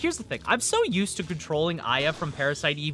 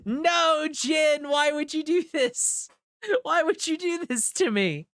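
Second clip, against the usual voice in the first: high wailing, half-laughing despair; a wounded cry of betrayal; betrayed and heartbroken; 11 semitones higher than usual. Real, not acted. Game froze, wailing at Jin.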